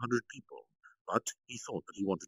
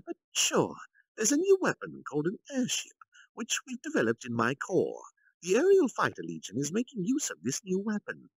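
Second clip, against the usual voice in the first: higher, assured general; Kalgurio, proud announcement; 7 semitones higher than usual. Acted. Kalgurio reveals the airship to the council, higher and proud. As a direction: present the trump card with pride.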